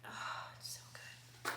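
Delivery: breathy whisper, almost voiceless; hushed reverent whisper. Acted. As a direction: whisper it like a secret. Hushed gushing over lip gloss.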